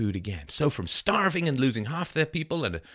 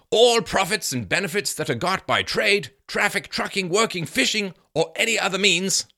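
louder, higher proclaiming voice; declaimed historical quote; 9 semitones higher, 4 dB louder than usual. Acted. Reading Bradford on common property, declaimed. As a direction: read it out like a decree. Reads Bradford's account aloud.

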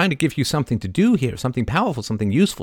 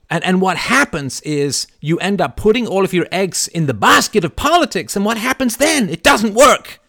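much higher and louder, driving the point home; passionate near-yell; 10 semitones higher, 5 dB louder than usual. Real, not acted. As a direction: preach it hard, almost shouting. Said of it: "I know it sounds like I'm yelling at you".

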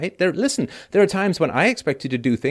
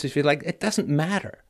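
normal talking voice

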